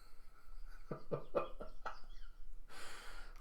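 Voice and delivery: quiet chuckling fit; real laughter. Real, not acted. Narrator amused by the double diversion plan, real laugh. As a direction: chuckle wordlessly at it.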